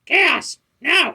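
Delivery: very high, loud, grating squawk; Gilbert Gottfried impression. Gamer does a Gilbert Gottfried squawk for the pet's lines, very high and loud. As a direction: squawk it out like a shrill comedian. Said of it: "like my Gilbert Gottfried impression".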